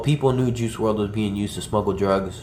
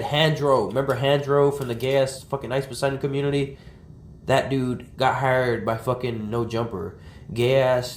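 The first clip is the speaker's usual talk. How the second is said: normal talking voice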